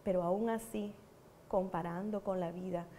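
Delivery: ordinary delivery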